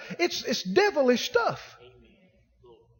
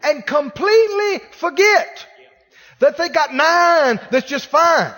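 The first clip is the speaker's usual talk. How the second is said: loud, slow, high preacher build; fervent sermon climax; preaching at full force; 5 semitones higher, 6 dB louder, slower than usual. Acted. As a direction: drive it home from the pulpit, slowly.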